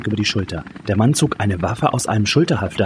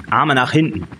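higher-pitched, firm command; rough order; 5 semitones higher than usual. A narrator's reading. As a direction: order a suspect about in a raised pitch. Man roughly orders her while arresting. (energischer, höherer Befehlston)

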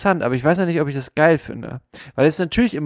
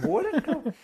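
high, laughing repeat; giggling high; 13 semitones higher, 7 dB quieter than usual. Real, not acted.

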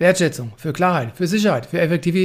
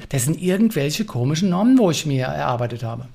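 usual speaking voice

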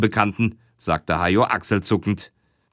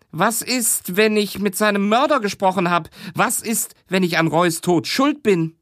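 higher, fearful questions; worried witness; 8 semitones higher than usual. Acted. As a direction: ask high as if afraid of guilt. Witness fears guilt.

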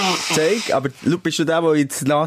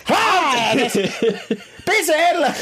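mock touched squeal; 14 semitones higher, much slower than usual. Dad hears "I love you.

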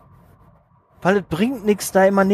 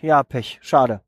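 a lower, deflated sigh of bad luck; resigned disappointment; 7 semitones lower, slower than usual. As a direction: drop low and let it go with a shrug. Imagined rejection shrugged off.

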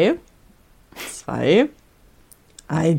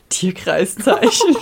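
laughing; 14 semitones higher, 3 dB louder, much slower than usual. Real, not acted. Slow laughing mock suggestion.

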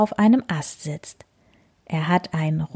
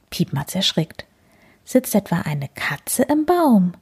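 ordinary delivery, nothing marked

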